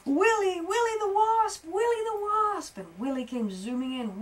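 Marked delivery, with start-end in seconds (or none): 0.0-2.8 loud high call. Acted. Beetle calls Willy the Wasp loud and high. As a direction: call loudly for help from afar. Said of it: "he started calling".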